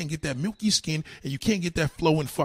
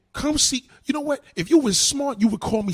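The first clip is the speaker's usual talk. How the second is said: loud challenge; 10 semitones higher, 5 dB louder than usual. Real, not acted. Challenging her to come.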